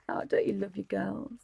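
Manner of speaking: ordinary talk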